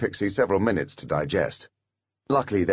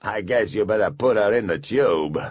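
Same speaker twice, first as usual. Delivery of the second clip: dwarf voice; 2 semitones higher, 4 dB louder than usual. A narrator's reading. Dwarf gives in.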